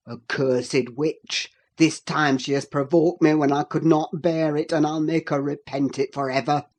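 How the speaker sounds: low, loud fury; furious Heathcliff. A narrator's reading. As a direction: curse her through clenched rage. Heathcliff seizes Catherine.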